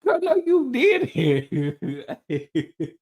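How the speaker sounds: high squeal breaking into laughter, the repeat slow; laughing disbelief. Retold or imitated. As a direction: giggle through the words, stunned. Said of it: "I was like".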